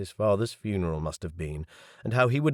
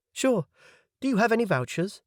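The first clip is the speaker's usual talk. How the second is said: high, quick, bright waitress voice; serving girl voice; 12 semitones higher than usual. Acted. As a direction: rattle it off like a cheerful waitress. Waitress asks for vouchers.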